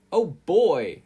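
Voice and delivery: loud, high burst of glee; excited exclaim. A narrator's reading. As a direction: blurt it out with delight. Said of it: "exclaimed Arnold".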